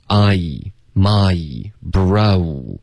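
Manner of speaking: each of "I, my, bro" with a full vowel glide; gliding diphthongs. Shown as a demonstration. Teacher drilling diphthongs for students, gliding. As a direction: slide through the vowels of "I, my, bro". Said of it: "所有的双元音都需要滑动".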